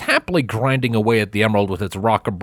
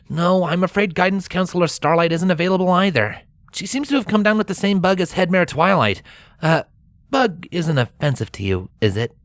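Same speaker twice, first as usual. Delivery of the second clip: put-on light, higher boyish voice; young dragon voice; 8 semitones higher than usual. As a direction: play a chatty young dragon. Spike voiced at door.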